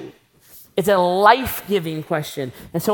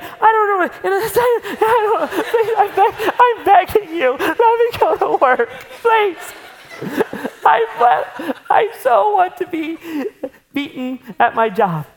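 very high, louder pleading with laughter; desperate husband begging; 11 semitones higher, 3 dB louder than usual. Pretend. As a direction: beg like a frazzled dad desperate to escape. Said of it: "you'll be like".

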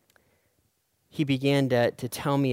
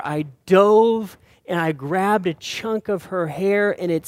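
loud, higher, building preacher's climax; preaching at full force; 6 semitones higher, 9 dB louder than usual. Acted. Pastor telling the congregation how he saved his daughter, loud and urgent. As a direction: drive the rescue story home, loud and urgent.

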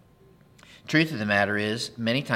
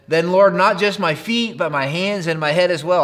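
louder, eager plea; eager Peter; 4 semitones higher, 6 dB louder than usual. A narrator's reading. Peter asks Jesus to wash him entirely, eager. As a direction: beg for more, all in.

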